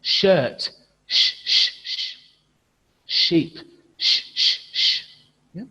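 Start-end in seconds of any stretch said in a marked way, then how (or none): 1.1-2.2 "sh, sh, sh" hissed with breath only; students' answer modelled; unvoiced sh. Shown as a demonstration. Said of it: "students repeat the sound". 4.0-5.0 "sh, sh, sh" hissed with breath only; students' answer modelled; unvoiced sh. Shown as a demonstration. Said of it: "students repeat the sound".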